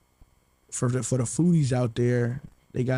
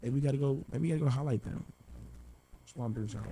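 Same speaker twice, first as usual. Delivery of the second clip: mumbling; 7 dB quieter, faster than usual. Real, not acted. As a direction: mumble it softly, half to yourself. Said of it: "probably 'cause you're mumbling".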